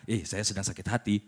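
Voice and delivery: soft, low and subdued; quiet version. Shown as a demonstration. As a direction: say it softly, holding back. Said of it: "Maksudnya saya bilang". (pelan dan lirih)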